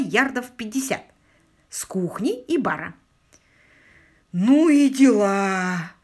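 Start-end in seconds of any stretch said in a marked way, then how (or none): 4.3-5.9 loud, drawn-out exclamation of amazement; stretched, awestruck outburst; indignant awe. A narrator's reading. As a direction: exclaim slowly, outraged yet impressed. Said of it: "воскликнул он возмущённо, но не без благоговения".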